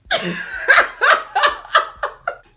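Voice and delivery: high, loud breathy burst of laughter; real loud laughter. Real, not acted. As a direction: crack up for real. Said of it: "when I started laughing real loud".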